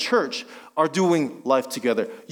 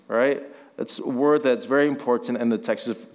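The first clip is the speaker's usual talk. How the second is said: ordinary talk, nothing marked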